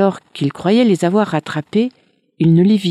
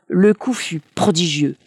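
ordinary delivery

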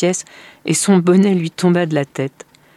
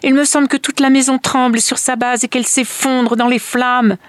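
high, urgent rush of dread; panicked protest; 8 semitones higher, 4 dB louder than usual. A narrator's reading. Mother protests that the house is falling in flames, panicked. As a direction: wail it as if the house were collapsing. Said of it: "protesta la mère".

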